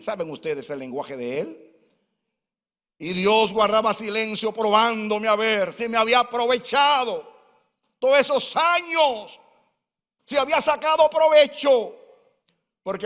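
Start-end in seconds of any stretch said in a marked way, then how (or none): 3.0-11.9 rising preaching. Acted. Pastor recounting his trial to the flock, rising fervor. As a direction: build the phrase up to a peak.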